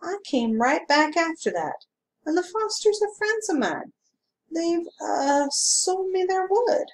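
a hint of a polite, careful man; smooth stranger. A narrator's reading. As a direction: stranger explaining himself to the constable. Man in yellow suit voiced.

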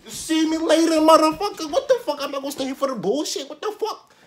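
high, loud storming-out rant; acted indignant outburst. Student grabbing the bag and leaving class, indignant retold outburst. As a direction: fire it off high and outraged.

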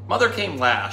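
usual speaking voice

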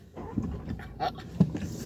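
high giggle. Real, not acted. Giggle after pleased remark.

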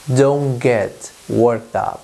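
slow, clear classroom example; slow model reading. Shown as a demonstration. Teacher to Spanish learners, slow careful model phrase. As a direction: spell it out slowly for the learners. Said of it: "Decir en inglés".

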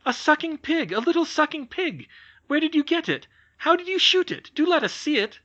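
little brothers thrilled at a hunting prize; excited boys. Acted. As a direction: tumble over each other with eager questions.

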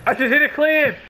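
hyped gamer bro. Acted. Repeated hyped catchphrase yell.

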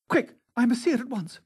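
high, pressing order; urgent exclaiming. A narrator's reading. Dorian to the gamekeeper, exclaiming urgently. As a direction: hurry him along, pitched right up. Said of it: "he exclaimed".

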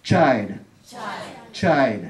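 put-on Child ending gently in "ld"; soft model pronunciation. Shown as a demonstration. Teacher showing the soft ending to students. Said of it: "Đọc phải có nhẹ nhẹ nhẹ".

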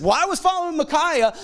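high-pitched mock church member; mimicked excuse. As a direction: parody someone making a feeble excuse.

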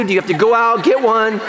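ordinary talk, nothing marked

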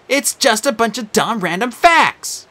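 insistent. Acted. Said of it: "Annabeth insisted".

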